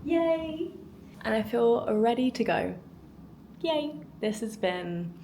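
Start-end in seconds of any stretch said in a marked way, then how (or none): none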